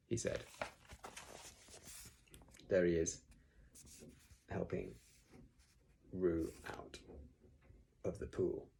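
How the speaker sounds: plain reading voice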